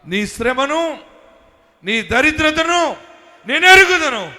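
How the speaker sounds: slow thundering proclamation; booming divine promise. Acted. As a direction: proclaim God's reassurance with full force. Preacher voicing God's promise.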